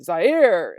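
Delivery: "Zaire" in two syllables, za-EER; "Zaire" with a heavy second syllable; corrected pronunciation. Shown as a demonstration. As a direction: stretch the stressed ending of "Zaire". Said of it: "is how I guess I should say it".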